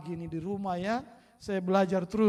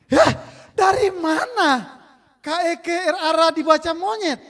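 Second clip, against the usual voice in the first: exasperated teacher; 11 semitones higher, 11 dB louder than usual. Retold or imitated. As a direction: wail in disbelief at the pupil.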